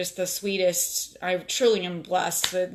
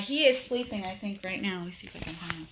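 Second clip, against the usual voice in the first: normal talking voice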